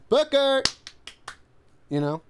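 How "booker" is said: high, loud overdone call; yelling Booker. Pretend. Friend mimics parent yelling for a lost kid. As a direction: holler the name as if lost in a crowd. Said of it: "I've done Booker".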